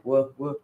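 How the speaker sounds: WOOF, WOOF as mushy W sounds; tongue-tied "woof, woof" in place of W; garbled W's. Shown as a demonstration. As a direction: say "woof, woof" like W's that won't come out. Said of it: "It just sounds like".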